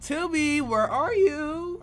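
loud, high, drawn-out call; playful calling out. Pretend. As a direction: call for someone hiding in another room. Calling out for a game character.

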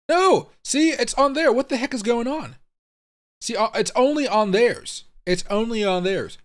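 high-pitched, alarmed outburst that eases slightly; real alarm. Real, not acted. Streamer reacting live to what the heck is going on, alarmed. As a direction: blurt it out high in startled disbelief.

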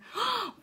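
high, sharp intake of breath; quick astonished gasp; amazed gasp. Acted. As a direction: gasp in delighted surprise. Gabby sees the gum gone.

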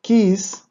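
Kiss, short i with a sharp s; Hebrew word kiss. Shown as a demonstration. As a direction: present the word Kiss clearly. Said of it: "El primer ejemplo es kiss". («Kiss» pronunciado en hebreo)

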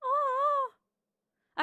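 high, crying-edged aww; touched aww. Real, not acted. Gamer moved by her sim's apology, high tearful aww. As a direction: melt at the apology.

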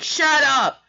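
high, loud, sudden shout; angry teacher yelling over the room; teacher's shout. Retold or imitated. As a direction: shout to silence a noisy class. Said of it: "the teacher was like".

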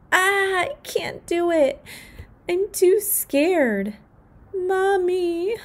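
high, slow, frightened; too scared to let go; scared child. Acted.

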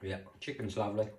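talking while chewing; chewing a bite of food; mouth full. Real, not acted. Seen 0: Bite at -7, cheeks show chewing through about 1.9s.

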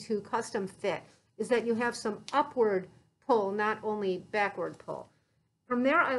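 muffled behind respirator mask; speaking while fitting mask; through a face mask. Real, not acted.

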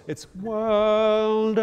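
sing-song correct "world", open and R-free. Shown as a demonstration. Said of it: "it's not a Kiwi German world".

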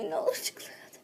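sly whispered aside. Acted. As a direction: whisper the plea. (fısıltı)

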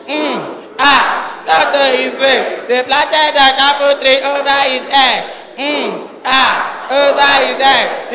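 put-on high sing-song little girl reciting; child reciting. Little girl reciting a poem to the auntie, high sing-song.

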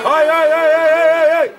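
rapid-fire string of high, loud shouts; urging shout. Real, not acted. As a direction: spur the runner on from the sideline. Urging players during play. (strigăte repetate de încurajare)

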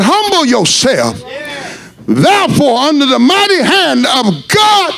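rising, shouted preaching, slowing down; preaching at full force. Acted. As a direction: build the sermon into a cry.

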